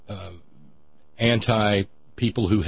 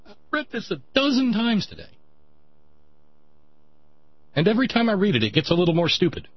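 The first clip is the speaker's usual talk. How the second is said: exasperated; 6 semitones higher, faster than usual. Real, not acted. Exasperated high-pitched rant.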